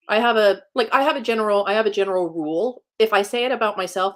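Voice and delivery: ordinary delivery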